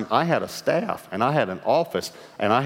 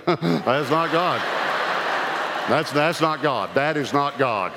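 slowed with laughter; 4 semitones higher, much slower than usual. Real, not acted. Laughing at his own thought.

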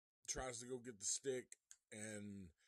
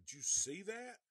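loud, high startled cry; a beachgoer shouting in alarm after the shark attack; shocked shout; 8 semitones higher, 7 dB louder than usual. Retold or imitated.

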